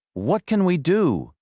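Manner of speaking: high, slow frightened question; scared plea. A narrator's reading. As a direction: ask it trembling with fear.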